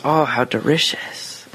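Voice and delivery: put-on slow, drawn-out Japanese-accented delight. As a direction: imitate a Japanese lady's accent, very slowly. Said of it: "her Japanese accent goes".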